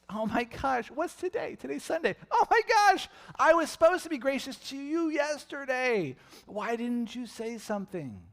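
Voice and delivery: high, loud, hammed-up panic; a comic flustered God, rising to a yell; mock panicked God. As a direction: play God suddenly remembering a missed chore.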